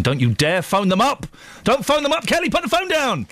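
playful mock shout at Kelly to drop the phone; mock telling off. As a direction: pretend to yell at a colleague across the studio. Host playfully scolds producer Kelly.

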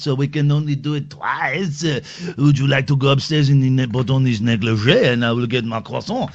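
put-on French accent. Comic Frenchman seducing a lady, mock French accent. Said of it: "Give me another country".

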